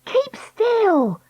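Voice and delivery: high, urgent command; warning. Acted. Dakota warning Treacle on the wobbling trolley, urgently. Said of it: "Dakota warned".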